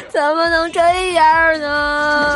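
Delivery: slow giggling protest. Acted. Teasing after being slapped.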